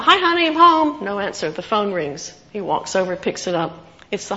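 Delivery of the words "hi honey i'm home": cheery call. Retold or imitated. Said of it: "He calls out".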